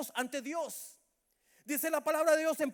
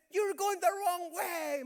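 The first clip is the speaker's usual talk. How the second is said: slow, higher-pitched mock yell; retold warning shout; 5 semitones higher, much slower than usual. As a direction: stretch it out like a stranger calling a warning. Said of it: "me gritaba y me decía".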